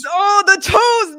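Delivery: drawn-out shout of delight; hollered slowly at the top of the voice; excited shout. Real, not acted. Podcast host shouting her love of wrestlers' toes, slow and loud.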